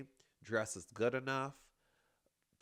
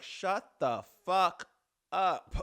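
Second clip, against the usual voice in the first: slow, loud, higher yell of "shut the fuck up"; imagined shout; 7 semitones higher, 9 dB louder, much slower than usual. Retold or imitated. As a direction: spit each word out as a hammed-up yell. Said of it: "I'd have been like".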